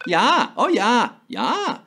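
high, drawn-out Minnesota "yah"; Midwestern accent. Acted. Host confirming the Midwestern answer, hammed-up Fargo accent.

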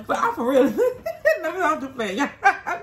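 high, laughing through the words; giggling while she talks. Real, not acted. Laughing at fat comments.

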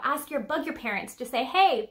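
usual speaking voice

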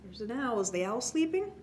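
ordinary delivery

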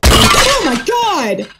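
long, high, loud frightened scream; drawn-out terrified shriek; real scream. Real, not acted. Jump scare in horror game.

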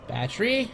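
BATTERY lifted high with a rising end; questioning. Pretend. Player searching for a battery, questioning.

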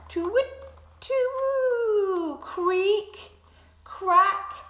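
high bird chirps; chick tweets. Acted. Another little bird hatches, high chirps.

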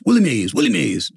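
a hint of the ladies' excited chanting; imitating the ladies. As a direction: echo the ladies cheering at the table.

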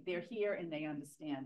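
usual speaking voice

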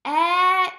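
K-etch-up in three loud beats; loud segmenting of K-etch-up; sounding out. Shown as a demonstration. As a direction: punch out K-etch-up syllable by syllable. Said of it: "Look at that".

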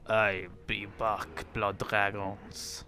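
put-on slow, low French-accented line. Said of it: "that was a French accent".

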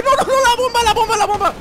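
high panicked yell about the bomb; grenade warning yell. Real, not acted. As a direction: shout the warning in alarm. Grenade lands nearby.

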